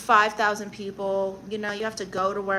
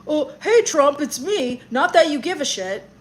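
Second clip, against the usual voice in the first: pretend phone call; 5 semitones higher, 8 dB louder than usual. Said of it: "Let me just call him on my phone".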